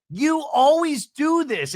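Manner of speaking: hammed-up angry castmate; mock accusation. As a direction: hurl the accusation. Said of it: "Emily immediately like".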